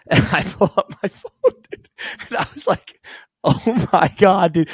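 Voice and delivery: high voice breaking up with laughter; laughing in disbelief. Real, not acted. Telling cohosts about learning of the trade, laughing in disbelief. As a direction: laugh helplessly through the words.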